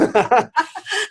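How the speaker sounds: bright, high burst of real laughter. Real, not acted. Laughs at his own story.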